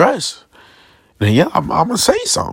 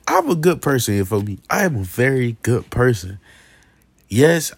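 normal talking voice

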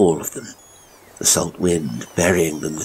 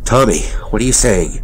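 raised, shocked father's voice; higher, louder dismay; aghast; 4 semitones higher, 5 dB louder, faster than usual. A narrator's reading. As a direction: question your son in horror. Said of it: "Elliot was aghast".

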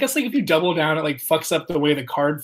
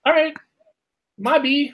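1 mock apology; 10 semitones higher than usual. Brushing off the annoyed player.

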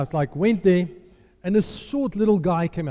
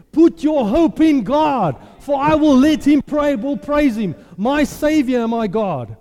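loud, high, slow proclamation; preaching at full force; 5 semitones higher, 7 dB louder, slower than usual. Acted. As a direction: declare it with fire.

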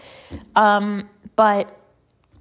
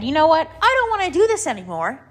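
determined; 7 semitones higher, faster than usual. Retold or imitated. Quoting her own decision.

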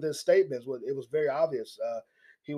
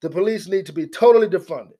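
louder, higher mock of her insistence; mocking the congresswoman; 3 semitones higher, 6 dB louder than usual. Commentator mocks the congresswoman's defiant words, louder. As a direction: ham up her defiant claims. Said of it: "from her words, not mine, she says".

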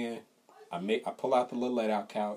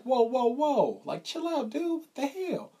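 high, alarmed "whoa, whoa, whoa"; startled protest; 13 semitones higher, 4 dB louder, slower than usual. Retold or imitated. The friend climbs on his lap, high startled protest. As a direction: push her off in high alarm. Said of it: "And I'm like".

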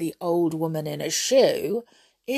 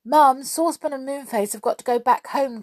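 higher, quick call to Mum; child calling to a parent; 7 semitones higher, 3 dB louder, faster than usual. A narrator's reading. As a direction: call it eagerly, at pace. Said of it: "called Joe".

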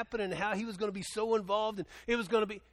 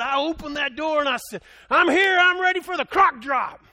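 much higher, much louder, chest puffed out; proud announcement; 9 semitones higher, 12 dB louder than usual. Retold or imitated. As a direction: announce yourself to the whole barn. Bob's grand entrance retold.

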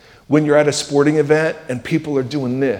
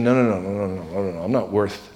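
inner doubting voice; 7 semitones lower, 9 dB quieter than usual. Retold or imitated. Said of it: "you're thinking".

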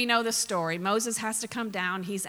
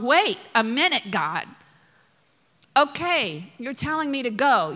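loud, emphatic interjection, dropping at the end; acted-out bold appeal in prayer; insistent plea; 4 dB louder than usual. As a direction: break in firmly to object. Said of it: "He's praying".

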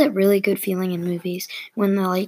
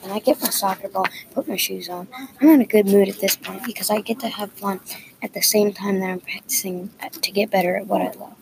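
plain reading voice

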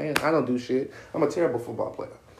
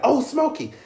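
coach calling the play; 11 semitones higher than usual. Retold or imitated. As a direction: call it out like a coach miming a cigarette. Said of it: "be like".

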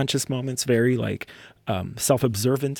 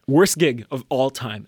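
normal talking voice

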